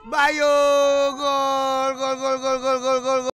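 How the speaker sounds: rising frantic goal scream; goal call. Real, not acted. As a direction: shout the goal, drawn out.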